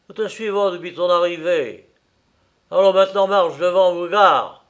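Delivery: high, loud orders building in force; commanding threat. Acted. Workman with revolver to caught Limerick, loud threatening command. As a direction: order him off with a warning. Armed man orders murderer to march.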